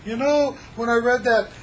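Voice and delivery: mock the confused student. Student misreading his instruction, high puzzled voice. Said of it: "somebody's gonna come to me".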